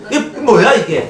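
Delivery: a laughing, hammed-up "what is this?"; mock annoyed outburst. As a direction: play the grumpy husband, half laughing. Acting irritated husband complaint.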